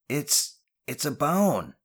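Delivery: high, halting startled call; shouting back. A narrator's reading. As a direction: stammer the discovery back, pitch up. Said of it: "Janetti shouted back".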